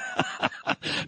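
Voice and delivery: soft, breathy burst of laughter; amused chuckle without words; real laugh. Real, not acted. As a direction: laugh it off quietly. Laughs at guest's doubting remark.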